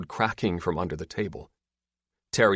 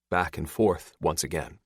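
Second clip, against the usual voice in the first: plain reading voice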